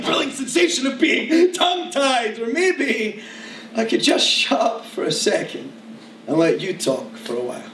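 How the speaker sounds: urgent poetry recital, easing down at the close; performance poem. Acted. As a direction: race and stretch the tongue twisters as slam verse. Performs slam poem full of tongue twisters.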